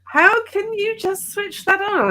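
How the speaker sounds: high, slow, amazed friends; incredulous friends. Retold or imitated. Friends quizzing the driver, high and slow with disbelief. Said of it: "my friends were like".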